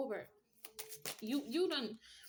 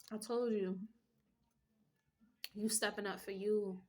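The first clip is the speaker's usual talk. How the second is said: ordinary talk, nothing marked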